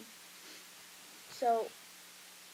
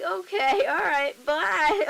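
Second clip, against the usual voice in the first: loud, high, stretched-out farewell; hammed-up high goodbye, drawing out the end; imitated flustered goodbye; 7 semitones higher, 15 dB louder, much slower than usual. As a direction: act out a hasty embarrassed sign-off. Retelling friend's flustered goodbye.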